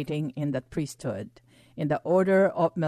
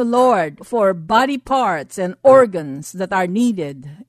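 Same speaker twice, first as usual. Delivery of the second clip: forceful healing prayer with authority; fierce commanding prayer; 5 dB louder than usual. Acted. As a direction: declare it like a commanding preacher. Prays healing over listeners.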